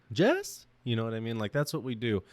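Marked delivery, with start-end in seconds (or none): 0.1-0.7 high rising "Jess?" like a surprised question; quizzical name call. Retold or imitated. People greeting him by his brother's name, high questioning call. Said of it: "people being like".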